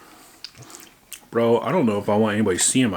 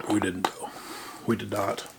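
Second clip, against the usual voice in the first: hushed whisper; a quick whispered aside; 8 dB quieter than usual. Real, not acted. Quiet aside to camera.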